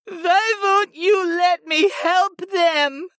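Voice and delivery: a touch of tearful grief; slow, high grieving plea. A narrator's reading. Vala begging her sister to let her help, slow and tearful. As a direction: drag the words out, close to tears.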